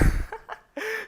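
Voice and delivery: high, loud cackle at own joke; loud laugh. Acted. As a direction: burst out laughing.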